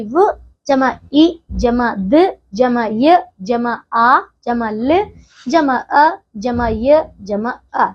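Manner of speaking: very slow, each sound spelled out with pauses; slow sound breakdown. Shown as a demonstration. Teacher splitting a word into sounds for children, very slow. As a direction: spell लड़का sound by sound, slowly. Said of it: "अब विद्यालय में भी ऐसे बच्चों".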